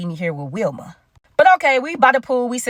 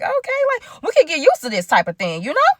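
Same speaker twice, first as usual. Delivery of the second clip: high-pitched, pleased approval; playfully impressed; 7 semitones higher than usual. Retold or imitated. Two friends offered slippers at a grand house, playfully impressed. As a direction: lift the voice high, savouring the treat.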